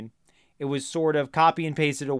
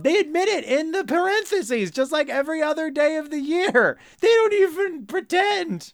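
much higher, incredulous squeal of outrage; high-pitched indignation; 12 semitones higher than usual. Real, not acted. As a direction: protest in a very high, exasperated voice. Ranting about a lazy card.

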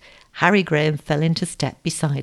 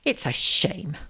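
ordinary delivery, nothing marked

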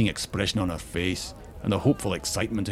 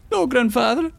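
put-on very high, loud child's wail; desperate high-pitched plea; 7 dB louder than usual. A narrator's reading. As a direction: wail as an anguished little girl. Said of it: "she wailed".

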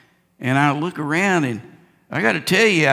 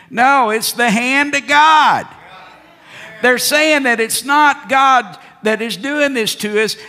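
higher, louder fiery preaching; preaching shout; 8 semitones higher, 6 dB louder than usual. Real, not acted. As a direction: thunder it from the pulpit. Preacher rebuts the pundits.

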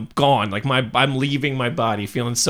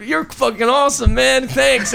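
talking through clenched teeth, higher; through gritted teeth; 9 semitones higher than usual. Retold or imitated. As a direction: jaw clamped, mouth shut, pitched up. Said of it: "And I'm talking through my teeth".

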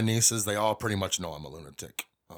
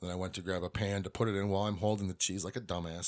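ordinary talk, nothing marked

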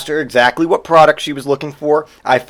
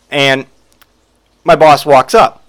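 normal talking voice

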